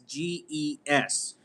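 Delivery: letter by letter, slowly; spelled out slowly. Shown as a demonstration. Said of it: "It's the company Guess".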